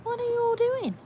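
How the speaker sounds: high, sing-song voice for the dog; voicing the dog. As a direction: speak for the watching dog, high. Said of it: "She's like".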